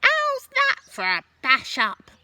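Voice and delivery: put-on high, loud old grandma voice; cackling grandma yelling from up high; Grandma shouting. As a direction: bellow down from the roof as a gleeful old woman. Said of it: "she shouted".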